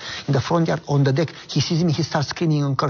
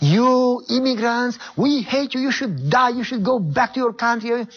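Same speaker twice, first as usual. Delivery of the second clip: imitating hateful neighbor; 8 semitones higher than usual. Man at door cursing immigrant neighbor, high hateful mock snarl. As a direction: spit it out like the hostile neighbor. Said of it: "he starts cursing me".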